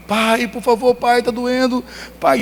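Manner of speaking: put-on high, pleading child's voice in pain; crying little boy. Toddler begging his father while the doctor cleans the wound, high and pleading. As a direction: beg your father to stop the pain. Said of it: "e ele".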